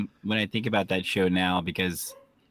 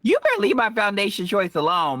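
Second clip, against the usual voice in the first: mock indignant; 10 semitones higher, 4 dB louder than usual. Mock defending her makeup.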